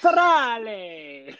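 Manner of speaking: high, stretched-out Italian flourish; mock Italian accent. Player echoes the car's name, hammed-up Italian accent. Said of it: "it's so much fun to say".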